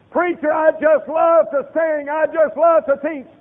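high, loud gushing church member; mock church member. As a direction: ham up a proud volunteer. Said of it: "He didn't say".

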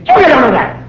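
scolding parent. Retold or imitated. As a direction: snap at the kid.

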